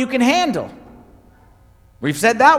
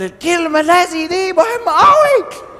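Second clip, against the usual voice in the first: higher, louder hammed-up preacher; mock fiery preaching; 9 semitones higher, 3 dB louder than usual. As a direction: preach it wildly. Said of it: "I'm screaming and I'm going crazy".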